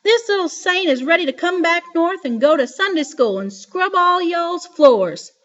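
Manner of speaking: put-on high, loud booming old woman; shouting grandma. A narrator's reading. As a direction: shout it down the phone line. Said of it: "while she shouted".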